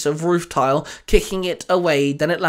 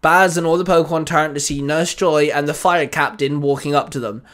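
usual speaking voice

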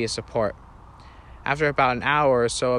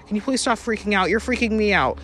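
high, urgent pleading; alarmed plea; 9 semitones higher, 5 dB louder than usual. Acted. Sister pleads with her panicking brother, high and urgent. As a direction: beg him to calm down, rattled yourself.

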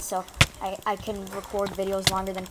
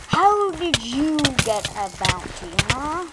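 mock taunt; 4 semitones higher, 7 dB louder, slower than usual. Taunting a player.

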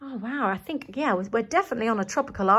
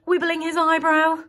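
ordinary delivery, nothing marked

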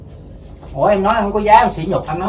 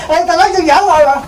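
laughing protest; 11 semitones higher, 4 dB louder, faster than usual. Real, not acted. Husband laughing it off.

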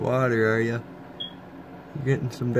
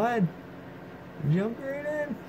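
high coaxing call; 11 semitones higher, 5 dB quieter than usual. Real, not acted. Urging lizard into water.

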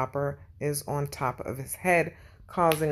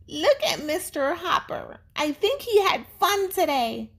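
put-on high, bright kid voice, delighted; cheerful little girl; 12 semitones higher than usual. A narrator's reading. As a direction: sound like a happy young girl pointing. Girl character cheerful line.

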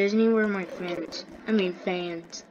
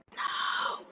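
breathy cry; 7 dB quieter than usual. Real, not acted. Falling in the game.

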